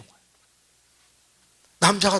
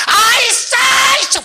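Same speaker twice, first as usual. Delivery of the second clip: high, loud bellow of irritation; rough shouted prayer; 10 dB louder, slower than usual. Retold or imitated. Foul-tempered deacon praying loudly in church, shouting. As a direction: blurt it out at full shout, imitating him. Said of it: "막 이런 식으로 하니까".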